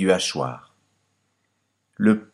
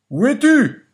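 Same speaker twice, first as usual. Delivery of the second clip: much higher, louder puzzled call; the farmer calls out high and loud, searching; master calling; 8 dB louder than usual. Acted. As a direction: call out looking round for a hidden voice. Master asks for the voice.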